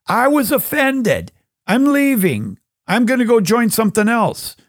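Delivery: flounce off in a huff; sassy stone. Acted. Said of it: "stones could be so sassy".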